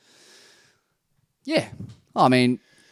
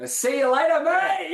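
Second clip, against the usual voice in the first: higher, cheery mock send-off; gleeful farewell; 12 semitones higher, 5 dB quieter than usual. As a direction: imitate a rival waving someone off. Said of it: "Yago's basically said".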